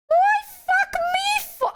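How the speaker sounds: very high, drawn-out squeal; exaggerated high, slow whine; mock outrage. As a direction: squeak out a mock-offended comeback. Acted comeback to scolding mom.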